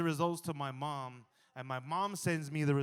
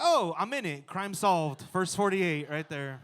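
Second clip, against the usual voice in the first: mock detective; 4 semitones higher, 9 dB louder, slower than usual. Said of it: "I was like".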